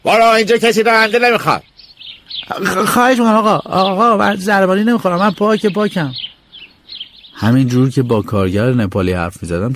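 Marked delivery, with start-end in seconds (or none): 0.0-1.6 higher, barking servant; mock shout. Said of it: "کارگر داد زد". 2.5-6.1 put-on much higher, desperate boy; boy pleading to be let in; stammering plea.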